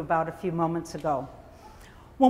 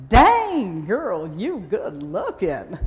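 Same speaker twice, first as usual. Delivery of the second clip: a Southern woman hyping herself up, loud and laughing; sassy Southern accent; 6 dB louder, slower than usual. Retold or imitated. Woman praising her mirror reflection, in a loud sassy Southern accent. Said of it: "sweet little Southern accent".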